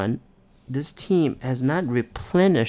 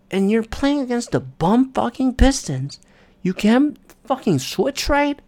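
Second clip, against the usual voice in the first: frustrated rant; 10 semitones higher than usual. Real, not acted. Ranting at team's failures.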